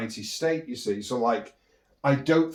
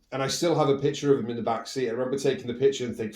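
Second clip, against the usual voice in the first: ordinary talk, nothing marked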